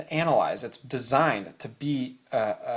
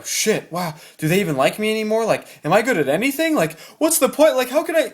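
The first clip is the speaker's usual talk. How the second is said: rushed, rising, exasperated inner voice; spiraling self-doubt; 7 semitones higher, 5 dB louder than usual. Acted. The mind reacting to criticism, spiraling mock panic. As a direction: climb higher and louder into mock panic. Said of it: "very easy for your mind to go".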